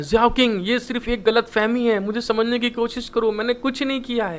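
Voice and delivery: ordinary talk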